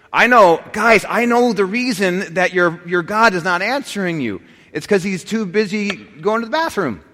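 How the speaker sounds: high, loud sneering taunt at the prophets; mocking Elijah. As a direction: jeer at them like Elijah scoffing at Baal's prophets. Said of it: "So this is just clear mockery".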